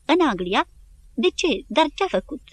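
rising, incredulous questions; can't believe her ears; shocked wife asking why; disbelief. A narrator's reading. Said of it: "nu-i venea să-și creadă urechilor".

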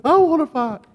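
very high-pitched tearful demand; mock crying. Crying best man insists on fighting, mock crying. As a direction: wail it like a sobbing man itching to fight. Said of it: "I mean, crying".

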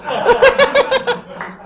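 very high, loud laugh; high laughter. Real, not acted. Friends laughing at the remembered embarrassment, high loud laughter. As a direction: burst out laughing.

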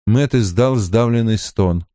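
usual speaking voice

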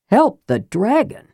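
incredulous. A narrator's reading. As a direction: throw it back in disbelief, rising at the end. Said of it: "Karaman goggled".

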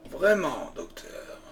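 sweetly mocking, the last word breathed away; butter him up with false politeness; honeyed tone. A narrator's reading. Holmes needling the hostile doctor, honeyed and mocking. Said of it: "d'un ton mielleux".

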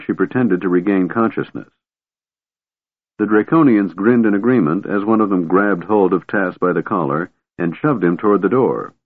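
plain reading voice

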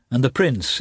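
usual speaking voice

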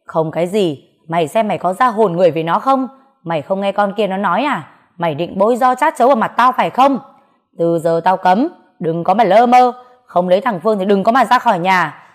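loud, fast, heated tirade; furious father. Acted. Father forbids his daughter to leave home, furious. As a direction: spit out the threats.

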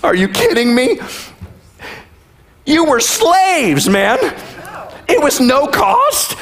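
high-pitched, disbelieving rebuke; exasperated, stretched-out disbelief; mock incredulity. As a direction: challenge them as if they've lost their minds. Preacher scoffs at the Israelites' complaint.

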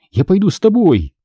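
high, pleading voice; tearful. A narrator's reading. Crying girl begging to go with Tom, tearful. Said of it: "снова заплакала она".

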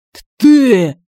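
high, loud choked yell; demon yell. A narrator's reading. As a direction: yell it while being strangled. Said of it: "завопил демон".

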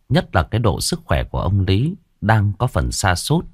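ordinary delivery

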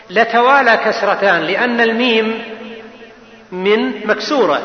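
ordinary talk

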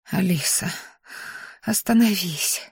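soft, slow self-warning; whispering to herself. A narrator's reading. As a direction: quietly tell yourself to stop. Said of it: "прошептала я сама себе".

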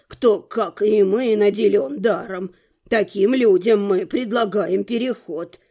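put-on low, measured old man's voice; old rector. A narrator's reading. Rector explains how mages are recruited, measured old man.

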